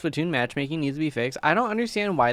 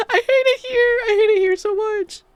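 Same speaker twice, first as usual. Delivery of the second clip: very high, loud theatrical whine; dramatic despair; 6 dB louder than usual. Real, not acted. Streamer vents about ranked losses to chat with a very high, loud, theatrical whine. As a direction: wail it like the world is ending.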